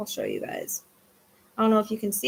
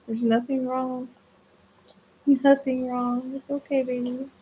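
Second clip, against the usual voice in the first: soothing dog; 2 semitones higher than usual. Real, not acted. Reassuring worried dog.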